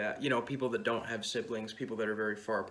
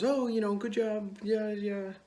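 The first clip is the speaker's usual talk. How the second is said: high-pitched, noncommittal encouragement; placating praise in a raised pitch; mock polite praise; 9 semitones higher than usual. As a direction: imitate someone politely humoring a beginner. Girlfriend politely praises sister's riding.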